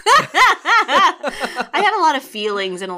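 laughing. Real, not acted. Laughs through the line.

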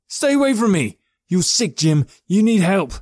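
high, loud terrified yell; panicked shout. Acted. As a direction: scream it in panic. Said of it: "Norji blurted".

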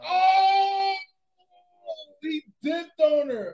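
high, loud shocked oh; shocked outcry. Real, not acted. Reacting to Iron Man leaving her, shocked loud outcry. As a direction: cry out in disbelief.

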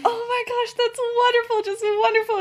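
high-pitched motherly gushing; gushing delight. Acted. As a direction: coo over wonderful news. Mother gushes over the good news.

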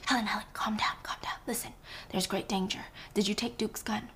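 hushed, urgent whisper; calm her down under your breath. Acted. Blanc whispering an urgent warning to Helen in the dark. Blanc warns of danger quietly.

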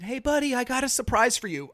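dad calling from the car window; yelled out the window. Retold or imitated. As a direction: call out brightly to coax the kid. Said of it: "So I yelled out the window to him".

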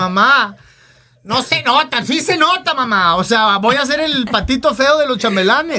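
put-on high whiny teen plea to mother; pleading with mom. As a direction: beg mom like a sulky kid. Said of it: "Le dije".

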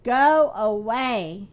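slow, low groan. A narrator's reading. Mister Tall sending Little Miss Helpful away, groaning. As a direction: drag it out low and weary. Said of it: "he groaned".

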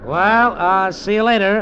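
forced breezy. Acted. Slipping away from uncle.

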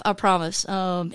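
ordinary talk, nothing marked

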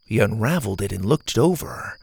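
plain reading voice

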